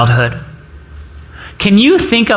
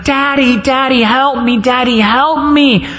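put-on high, pleading child's cry for help; a hurt child wailing for his dad; retold crying plea; 8 semitones higher than usual. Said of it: "and I'm crying".

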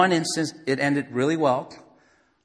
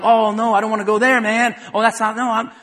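loud, high, flustered backpedal; awkward flustered reply; 8 semitones higher, 9 dB louder than usual. Retold or imitated. Preacher acting out a flustered evangelist, loud and high. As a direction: stammer out an awkward no. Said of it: "that's awkward".

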